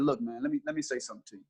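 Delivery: quiet, calm and steady. Retold or imitated. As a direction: settle him down gently. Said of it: "And I was very calm".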